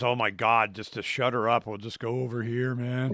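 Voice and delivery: grumbling cop under his breath; mock weary cop. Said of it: "they're just".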